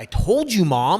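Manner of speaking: loud, high, triumphant told-you-so; excited. Retold or imitated. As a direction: crow it at your mom. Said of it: "and I was so excited".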